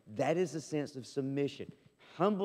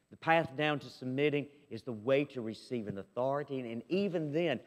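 normal talking voice

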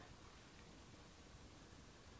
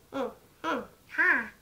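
ordinary delivery, nothing marked

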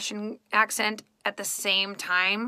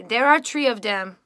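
French Canadian accent, hard d and t for th; Quebec-style French accent, th as d and t; 6 dB louder than usual. Shown as a demonstration. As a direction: demonstrate French Canadian English, punched out louder. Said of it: "a French Canadian, which is my family, they say".

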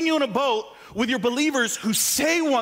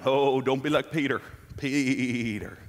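low mock Southern preacher accent; horrible Southern accent; 11 semitones lower, slower than usual. Imitating preachers heard on the radio, bad Southern accent. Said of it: "doing a horrible Southern accent".

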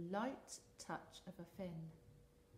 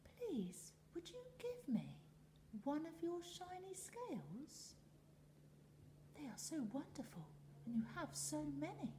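little fish voice; 10 semitones higher than usual. Acted.

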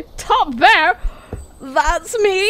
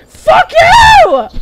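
high, loud yell of rage; furious shout; 10 dB louder than usual. Real, not acted. Gamer screaming at the rabbit that escaped.

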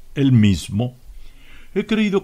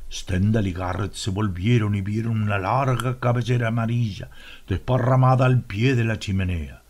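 ordinary delivery, nothing marked

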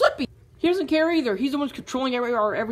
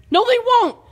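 high, loud insistence; loud stubborn denial; loud protest; 9 semitones higher, 5 dB louder than usual. Acted. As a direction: shout it down stubbornly. Quarrel over nerves.

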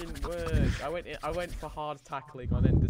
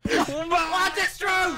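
high, loud, drawn-out yell; loud high-pitched protest; outraged shout; 13 semitones higher, 10 dB louder, much slower than usual. Real, not acted. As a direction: yell it in disbelief. Outburst at a match event.

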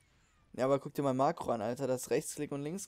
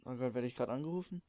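normal talking voice

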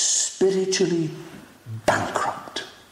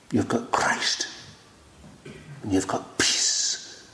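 soft whisper; 7 semitones lower, 5 dB quieter, slower than usual. Real, not acted. Preacher softens to a whisper.

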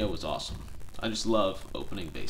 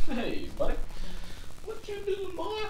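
high squeaky cat-talk, quieter at the end; pet talk; 3 dB quieter than usual. Real, not acted. Talking to his cat Carl.

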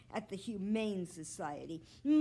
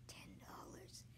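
breathless whisper of the sum; awed whisper; 17 dB quieter than usual. A narrator's reading. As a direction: whisper it like a secret fortune.

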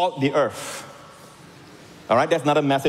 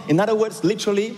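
ordinary delivery

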